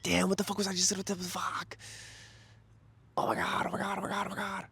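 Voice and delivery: high, fading flustered exclamations. Real, not acted. Vlogger flustered at forgetting his story, trailing off high.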